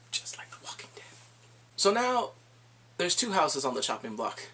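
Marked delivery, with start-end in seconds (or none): 0.1-1.0 conspiratorial whisper. Real, not acted. Aside about killing off characters.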